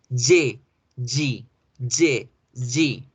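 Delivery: each "Y" a separate buzzed j syllable; Y of yuca. Shown as a demonstration. Teacher demonstrates the English J and G sound, buzzed like yuca. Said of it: "se hacen con la Y de yuca".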